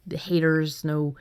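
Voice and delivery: normal talking voice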